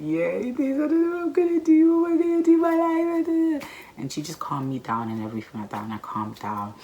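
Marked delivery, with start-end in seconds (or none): 0.0-3.6 much higher and louder, a hammed-up panicky cry; panicked wail. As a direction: wail it like your life is over. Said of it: "I was basically like".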